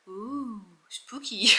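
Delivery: playful ghostly whisper; spooky whisper. Pretend. Reviewer teasing the mystery side of a book, spooky whisper. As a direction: whisper it as a mock scare.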